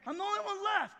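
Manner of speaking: high-pitched aggrieved protest; griping at the umpire. Acted. Elijah kicking dirt as he complains to God, griping. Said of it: "he's kicking dirt on the umpires".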